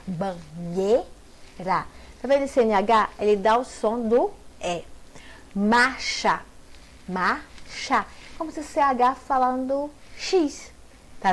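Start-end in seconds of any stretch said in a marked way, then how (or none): 0.1-1.1 slow banheira, ba-nhei-ra with pauses; syllable by syllable. Shown as a demonstration. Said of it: "a separação de sons". 7.1-8.1 marcha split into mar and cha with a gap; syllable by syllable. Shown as a demonstration. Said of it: "a separação de sons".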